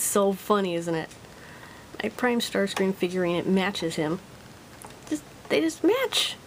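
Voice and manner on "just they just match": high, gushing delight; giddy high-pitched gush; excited squeal. Real, not acted. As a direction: squeal with delight over the toys. Gushing over matching toy.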